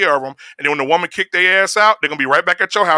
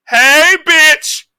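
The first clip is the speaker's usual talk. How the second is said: much higher, loud crude yell; rude catcall; 11 semitones higher, 9 dB louder than usual. Retold or imitated. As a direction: bark it out as a crass catcall. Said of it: "It went from that to".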